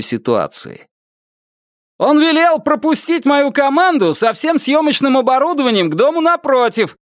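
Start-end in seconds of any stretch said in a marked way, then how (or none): 2.0-7.0 shouting. A narrator's reading. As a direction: yell it out to the street. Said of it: "прокричал он".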